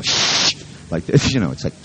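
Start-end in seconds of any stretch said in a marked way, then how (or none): 0.0-0.6 long mock "shh" from an alert dog; imitated shushing. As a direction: hush the chattering soldiers sharply. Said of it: "dogs even turn around and they go".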